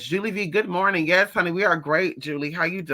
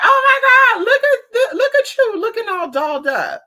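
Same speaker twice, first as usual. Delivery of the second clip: high, loud squealing shopgirl voice; mock shriek of delighted clerks; imitating excited store girls; 8 dB louder than usual. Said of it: "and they're like".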